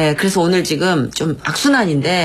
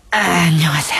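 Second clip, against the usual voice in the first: put-on greasy old-time DJ; 2 semitones lower than usual. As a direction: imitate an oily DJ, heavy on the breath. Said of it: "들숨과 날숨이 되게 기름졌어요". (끙끙 앓다가 내뱉는 느끼한 옛날 DJ 말투)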